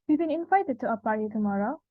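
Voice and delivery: quick question, pitch climbing on "tomorrow"; rising question pitch. Shown as a demonstration. Presenter repeating a question example, "tomorrow" rising in pitch. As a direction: lift the end of "tomorrow" to ask. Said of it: "because we use a rise pitch".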